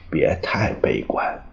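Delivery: ordinary delivery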